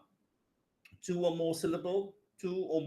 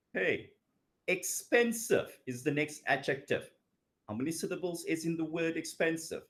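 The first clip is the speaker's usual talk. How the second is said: ordinary delivery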